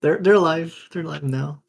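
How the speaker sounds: high, stammered backtracking; hasty high-pitched damage control; flustered reassurance. Acted. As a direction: blurt it out loud, then ease off. Hurriedly backtracks after startling listener.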